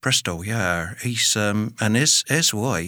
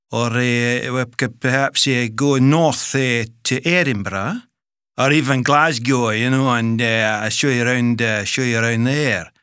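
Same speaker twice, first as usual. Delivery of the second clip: put-on Scottish accent. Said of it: "have a stab at most British accents".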